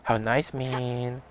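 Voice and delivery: ordinary talk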